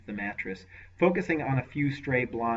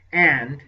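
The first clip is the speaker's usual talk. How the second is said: "And" punched loud and held; emphasis on And; 5 semitones higher, 14 dB louder than usual. Acted. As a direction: lean hard on "And". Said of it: "he laid unusual emphasis on the word".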